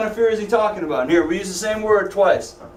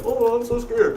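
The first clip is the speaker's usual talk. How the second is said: mock scared; 5 semitones higher than usual. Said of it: "Not like, a".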